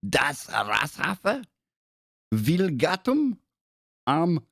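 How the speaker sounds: hammed-up German accent, slow and high; slow, emphatic German pronunciation. Shown as a demonstration. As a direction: read it out in your best mock German. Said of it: "That's my best German accent".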